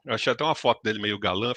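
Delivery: normal talking voice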